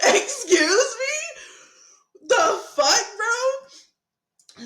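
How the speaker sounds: loud, very high, drawn-out disbelief; hammed-up offended shriek with a laugh in it; incredulous outrage. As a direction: stretch it right out, squealing in mock outrage. Repeating her outraged disbelief.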